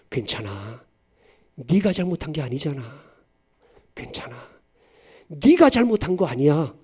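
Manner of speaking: calm a sobbing daughter; mother consoling her daughter tenderly; comforting mother. Retold or imitated. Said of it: "이렇게 위로해 줍니다".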